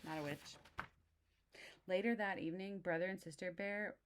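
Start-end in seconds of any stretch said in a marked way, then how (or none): none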